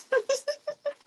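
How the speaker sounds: high-pitched laugh; high giggle. Real, not acted. Laughing at the pet.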